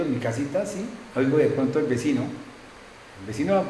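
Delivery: ordinary delivery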